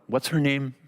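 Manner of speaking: plain reading voice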